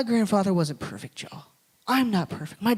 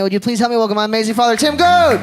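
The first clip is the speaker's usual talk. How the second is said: fast, building to a shouted name; excited introduction; 5 semitones higher, 8 dB louder than usual. Real, not acted. Son introducing his father to the congregation, rising to a shout. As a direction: call Dad up to the stage like an announcer.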